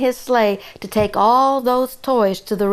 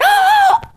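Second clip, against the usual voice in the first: high loud delighted gasp; excited gasp; 7 dB louder than usual. A narrator's reading. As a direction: gasp with sudden joy. Bear sees Santa.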